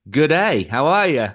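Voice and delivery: a cheerful but clumsy Australian greeting; poor Aussie accent. Pretend. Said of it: "my poor attempt at an Aussie accent".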